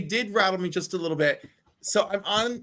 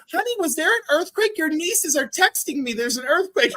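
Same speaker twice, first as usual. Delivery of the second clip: much higher, light mock-motherly voice; a hint of a fussing mother; lightly voiced mom; 8 semitones higher than usual. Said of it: "And she goes".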